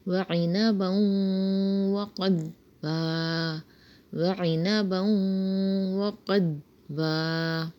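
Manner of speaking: slow, drawn-out tajweed recitation; slow Quran recitation. Shown as a demonstration. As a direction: recite the ayah slowly with stretched vowels. Recites the next ayah.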